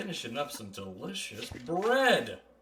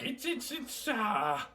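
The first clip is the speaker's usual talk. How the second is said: dwarf; 12 semitones higher, 3 dB quieter, slower than usual. Acted. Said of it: "he's like".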